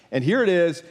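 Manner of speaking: ordinary talk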